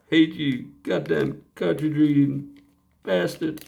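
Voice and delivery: mock-curse the broken part; playful fake rage at the dead part; mock angry curse. Cursing at the broken cartridge reader.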